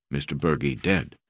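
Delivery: low, grave broken English; crewman's voice. Acted. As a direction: say it plainly and heavily. Crewman tells Bergie died.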